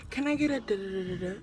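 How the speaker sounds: soft and fast, mumbling the order; mumbled quiet order. Retold or imitated. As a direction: rush it under your breath like a shy girl. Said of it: "she's like".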